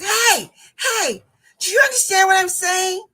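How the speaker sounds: high, loud appeal to a passer-by; urgent retold yell, rising at the end; frustrated calling out. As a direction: imitate a student shouting for someone to understand. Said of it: "frustrated, and she's like this".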